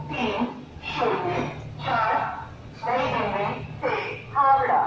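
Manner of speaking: station loudspeaker announcement; booming platform announcement with echo; over a PA system. Real, not acted. (Station loudspeaker पर घोषणा)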